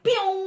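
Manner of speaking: high squeak of running off; a quick escape noise, high; mock zoom sound. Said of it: "I was like".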